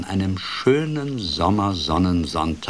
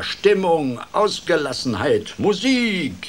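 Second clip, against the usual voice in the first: much higher and louder, rising to a shrieking top; crowing; 10 semitones higher, 5 dB louder than usual. Acted. Frog whipping up the pond party, loud crowing calls. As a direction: call out each word, climbing higher. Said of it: "krähte er".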